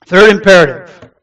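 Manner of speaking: loud, firm announcement of a heading; loud restart. Real, not acted. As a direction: gather yourself and announce it forcefully. Restarting after getting emotional.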